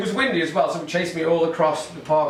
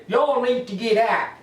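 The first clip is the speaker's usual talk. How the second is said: put-on Texas accent; 8 semitones higher than usual. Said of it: "That's my Texas accent right there".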